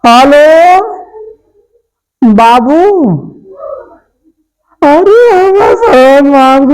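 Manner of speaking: calling out on phone. Acted. Shouting into the phone.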